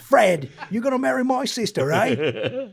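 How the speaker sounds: high-pitched tipsy Cockney ribbing; drunken old accent. Acted. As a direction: tease in an exaggerated Cockney accent. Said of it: "once they start drinking".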